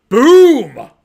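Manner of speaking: loud, high reveal shout; excited boom. Real, not acted. Booktuber unveiling his new book, loud and triumphant. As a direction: slam it down like a big reveal.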